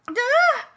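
loud, very high-pitched shriek; high terrified screech; scream. A narrator's reading. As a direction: let out a piercing scream. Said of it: "Darlene was not done screaming either".

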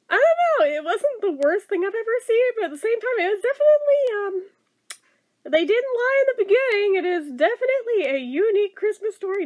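high, loud, excited rush; wrapping up the review, suddenly animated. Real, not acted. Bright animated rush.

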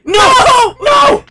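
very high, very loud screaming; anguished scream. Real, not acted. Gamer losing progress in the game, anguished scream. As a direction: scream in despair at the loss.

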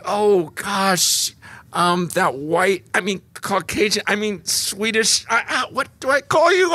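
high, anxious stammering voice, rising to a squeak; flustered worried person. Retold or imitated. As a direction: play someone terrified of saying the wrong word. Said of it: "'Cause it's like".

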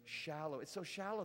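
usual speaking voice

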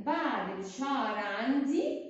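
normal talking voice

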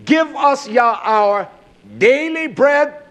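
daily bread drawn out slow and weighty; slow down and lean on daily bread; stress on daily. Shown as a demonstration. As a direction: quote the prayer slowly, leaning on daily. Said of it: "I emphasize the word daily".

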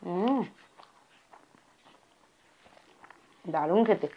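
talking while chewing food; muffled words around food; mouth full, chewing. Real, not acted. Seen 0: Bite taken at -2; cheeks bulge and jaw chews through the words.

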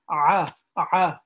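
a throaty ayn sound, pressed out loud; throat ayn sound. Shown as a demonstration. Teacher isolating the ayn sound for students, loud and throaty. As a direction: clench the throat and push out "ah". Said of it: "from the throat".

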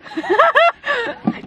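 sudden high laugh bursting out; high burst of laughter. Real, not acted. Surprised at the camera.

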